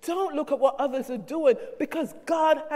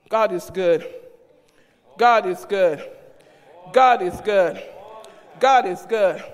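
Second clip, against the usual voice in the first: low, louder each time; call and response; 4 semitones lower, 4 dB louder than usual. Acted. Pastor calling God is good to the saints, building refrain. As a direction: chant it to the church.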